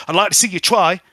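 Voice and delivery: put-on high, loud cockney girl snapping at the radio; squeaky street urchin, loud and rude; [name]'s voice. [name] griping at the truck radio, high and loud.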